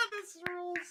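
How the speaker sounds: laughing. Real, not acted. Laughing after the move.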